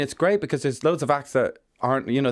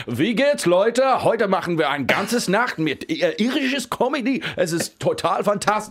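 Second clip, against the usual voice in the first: put-on higher, slow Irish-accented German stage patter; Irishman's clumsy German; 7 semitones higher, slower than usual. Comedian mock-opens a German gig: slow, high, Irish-accented German. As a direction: greet the crowd in hammy German with an Irish accent. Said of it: "and I'm like".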